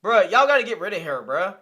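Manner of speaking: loud, fired-up yell at the host; bursting out loud in disbelief; outraged shout. Real, not acted. Outraged at guest's behavior.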